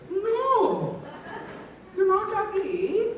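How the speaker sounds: high, slow Indian mom voice. Retold or imitated. Son asks mom about being called fat, she answers in a high mom voice. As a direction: imitate his mom, high and drawn out.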